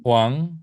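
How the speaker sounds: HUANG with a short rise; second-tone demo. Shown as a demonstration. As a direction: lift HUANG briefly upward. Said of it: "nada dua lebih pendek".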